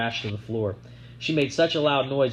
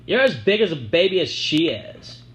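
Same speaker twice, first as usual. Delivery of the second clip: mocking; 6 semitones higher, 5 dB louder than usual. A narrator's reading. Sissy mocking.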